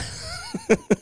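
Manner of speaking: high burst of laughter; laughing at his own joke. Real, not acted.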